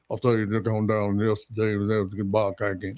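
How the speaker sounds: mumbled mock Southern drawl, words run together; unintelligible Southern drawl. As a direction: imitate two incomprehensible Georgia locals' drawl. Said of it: "I could not understand anything they were saying".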